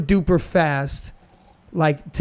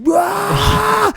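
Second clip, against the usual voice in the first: high, drawn-out battle yell; imitated scream; 4 dB louder than usual. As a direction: scream like a skater psyching himself up. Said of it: "Like, he just screams".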